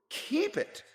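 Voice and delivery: high, loud amused disbelief; incredulous. Acted. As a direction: echo it in disbelief. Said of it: "repeated Scrooge's nephew".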